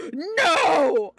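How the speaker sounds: re-enacted yell of NO; yelled denial. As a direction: shout it out in acted disbelief. Acted disbelief at the news.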